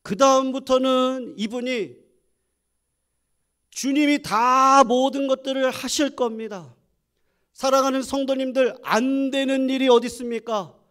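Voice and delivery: high, loud preaching cadence; full-force preaching. Acted. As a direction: proclaim it to the whole congregation. Preaching climax to congregation.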